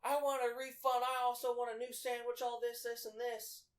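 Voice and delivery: high, loud monotone rant; mock screaming customer. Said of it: "screaming bloody, mur-, you know, bloody murder".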